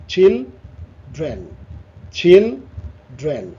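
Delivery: "Children" as চিল, pause, drain; split in two. Shown as a demonstration. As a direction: say "children" in two separate beats, slowly. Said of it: "এখানে দুটো". ("Children" দুই ভাগে থেমে থেমে)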